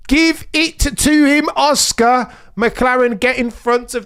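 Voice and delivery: excited urging. Real, not acted. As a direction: beg them to swap the cars. Urging team orders while watching.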